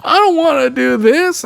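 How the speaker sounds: high-pitched whiny crying imitation; mock crying. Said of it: "She starts crying on a TikTok".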